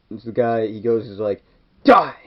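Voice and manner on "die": high, loud yell of attack; a kid acting a villain's attack cry; mock battle yell. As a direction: scream it as you charge.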